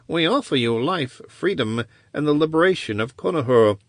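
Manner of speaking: a hint of a sly tempter; tempting offer. Wizard offering the prisoner freedom, sly.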